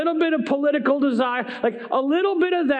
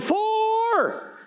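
Four! called out much higher, a golf warning; yelled Four; 11 semitones higher than usual. A narrator's reading. Preacher voicing Jesus warning disciples, golf warning yell. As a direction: shout it across the course. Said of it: "he yelled".

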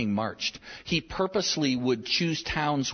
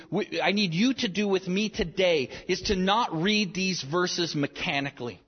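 ordinary delivery

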